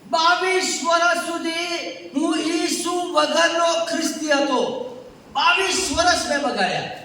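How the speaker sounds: loud, driving pulpit delivery; preaching at full force. Acted. Preacher to congregation, forceful preaching. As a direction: hammer each line home to the church.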